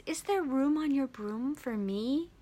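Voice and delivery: high, whispery little dog voice; pleading little dog. Retold or imitated. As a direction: ask timidly in a soft, airy high voice. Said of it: "he asks".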